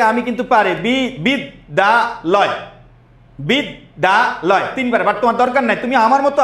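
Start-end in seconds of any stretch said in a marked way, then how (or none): 0.7-2.5 বিদ্যালয় broken into slow, separate syllables. Shown as a demonstration. Teacher shows students an easy method, syllable by syllable. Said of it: "আমরা কিন্তু এটা এভাবে". 3.4-4.6 বিদ্যালয় in three slow, separate beats; syllable by syllable. Shown as a demonstration. Teacher counts three syllables for the class, syllable by syllable. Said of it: "আমরা কিন্তু এটা এভাবে".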